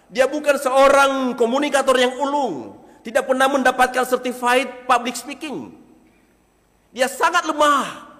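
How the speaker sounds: preaching. Acted. Pastor preaching on Moses to the congregation, high and loud. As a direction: preach it out to the hall.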